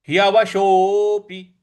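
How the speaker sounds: teacher demonstrating the word; model pronunciation. Shown as a demonstration. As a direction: say ह्यवशोऽपि with a drawn ओ. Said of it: "इस प्रकार से बोलेंगे".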